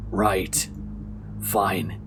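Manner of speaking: put-on low, quiet suspicious old woman; old widow voice. The widow questions the TV crew, low and quiet. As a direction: drop low and soft, a wary widow.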